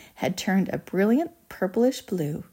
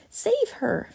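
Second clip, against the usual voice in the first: desperate cry; 14 semitones higher than usual. A narrator's reading. Mother begs for Violet's rescue, desperate cry. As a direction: plead in high panic. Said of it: "cried Missus Beauregard, wringing her hands".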